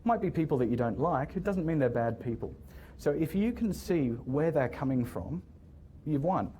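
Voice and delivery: normal talking voice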